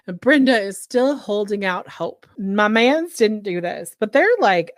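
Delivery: normal talking voice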